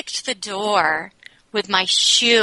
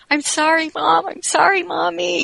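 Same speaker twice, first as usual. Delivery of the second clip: put-on higher, small child's voice saying sorry; little son pleading; 9 semitones higher than usual. Five-year-old son hugging his crying mom, childlike pleading. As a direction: imitate a tearful five-year-old, pitched up. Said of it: "and he was saying".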